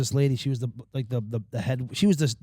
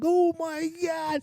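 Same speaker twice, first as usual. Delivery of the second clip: high, drawn-out squeal; retold hilarity; much slower than usual. Prankster to his friend after the call, squealed hilarity. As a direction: squeak it out slowly.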